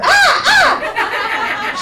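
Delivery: high, loud laughing yelp; startled scream. Retold or imitated. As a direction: yelp as the noise hits you. Shocked by parrot noise.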